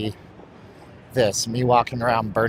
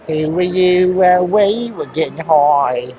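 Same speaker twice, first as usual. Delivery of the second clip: put-on slow sung British accent, louder; 4 semitones higher, 4 dB louder, slower than usual. Singing Oasis in a put-on British accent. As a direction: croon like a Brit, drawn out. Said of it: "I talk like a British person".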